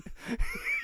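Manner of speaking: squealing laugh up high; high-pitched cackle. Real, not acted. Cracking up at his own breakfast story, squealing laugh.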